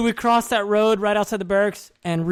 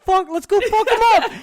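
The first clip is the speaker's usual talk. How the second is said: much higher, louder hype shout; rallying yell; 11 semitones higher, 5 dB louder than usual. Retold or imitated. Pumping up the squad for the brawl, hype yell. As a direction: rile the crew up to fight.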